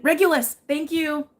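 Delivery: sudden high, loud burst of recognition; excited recall. Real, not acted. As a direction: blurt it out, thrilled to finally remember. Remembers the star name at last.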